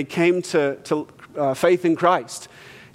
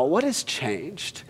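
gentle; 2 semitones lower, 7 dB quieter, much slower than usual. Real, not acted. Pastor asks tender question.

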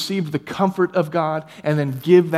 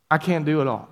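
ordinary talk

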